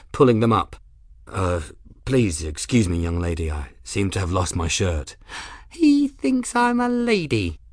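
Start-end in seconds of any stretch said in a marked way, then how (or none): 1.3-5.2 low-pitched, hesitant gentleman; apologetic man. Acted. Grim excuses himself to the young lady, low apologetic voice. 5.7-7.6 high, slow, drawn-out delight; delighted beaming. A narrator's reading. Odd girl flattered at being called a lady, high delighted coo. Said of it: "and beamed at him".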